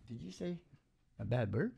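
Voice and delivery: normal talking voice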